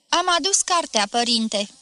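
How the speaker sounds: usual speaking voice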